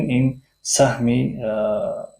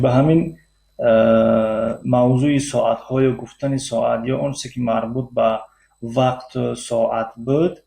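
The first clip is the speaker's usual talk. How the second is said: usual speaking voice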